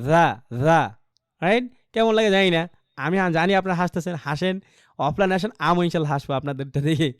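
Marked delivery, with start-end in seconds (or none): 0.0-1.0 জা, জা said with a wet tongue tip; wet dha sound. Shown as a demonstration. Teacher showing the class a wet জা. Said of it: "অনেকটা এরকম হয়".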